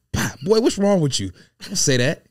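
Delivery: retold scolding yell over the slap; scolding shout. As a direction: bark it at him like a scolded kid. Slapping him while scolding, retold.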